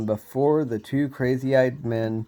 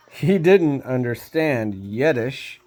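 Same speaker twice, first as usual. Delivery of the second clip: ordinary delivery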